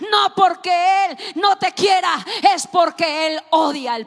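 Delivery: loud, high shouting sermon; preaching at full force. Acted. Preacher shouting Jesus's cry from the cross, full force. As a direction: cry it out at the top of your voice.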